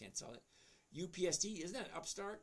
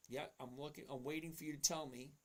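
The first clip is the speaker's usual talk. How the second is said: ordinary delivery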